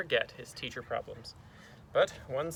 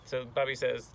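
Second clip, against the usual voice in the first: ordinary delivery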